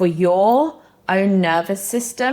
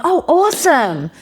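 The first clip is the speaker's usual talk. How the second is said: high, loud, gushing sales pitch; eager salesman; 8 semitones higher, 4 dB louder than usual. Retold or imitated. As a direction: overeager sales rep cutting in. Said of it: "hearing sales reps going".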